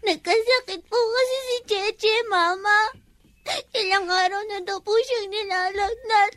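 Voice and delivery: put-on child sobbing through an account; words broken up by sobs; tearful explaining. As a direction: report it to mother while crying. Crying child reports the accusation.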